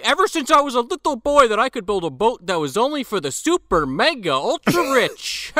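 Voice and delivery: high, loud, laughing send-up of a smug billionaire; mock pompous tycoon speech. Podcaster mocking Chilton's cruise ship speech, laughing parody. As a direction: ham up the rich captain's boat speech while cracking up. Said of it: "doing his fucking, uh, speech".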